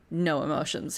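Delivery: ordinary talk, nothing marked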